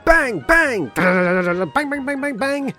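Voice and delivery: loud, high shouted bang bang; gunshot noises. Acted. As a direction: mimic gunfire with your voice. Gunfire imitated.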